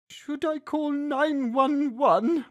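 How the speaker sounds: put-on high, slow worried girl voice; lightly voiced nervous kid; anxious daughter. A narrator's reading. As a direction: daughter offering to phone for help.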